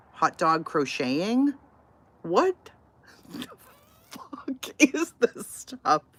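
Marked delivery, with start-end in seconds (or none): 2.2-6.0 high, loud and slow outraged what the; loud disbelief. Real, not acted. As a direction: stretch it out, baffled and loud. Baffled by strange channels.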